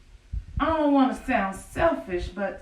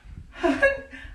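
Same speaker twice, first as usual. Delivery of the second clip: a bubbling high laugh, caught tickled; high giggle; 12 semitones higher than usual. Acted. Woman praying to the Lord, breaks into a high giggle. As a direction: let the giggle rise up.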